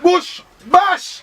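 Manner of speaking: high, ringing announcer call; announcer's name reveal. Real, not acted. Host reveals the artist's name, high announcer shout. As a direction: call out the name for a big entrance.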